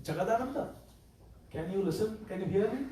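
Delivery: soft, gentle questions; very softly. Retold or imitated. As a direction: coax him quietly through the door. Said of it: "Very softly".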